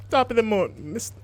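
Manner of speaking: high, bad Irish accent attempt; second go at an Irish accent. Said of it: "my Irish accent's gonna come out, like, Jamaican".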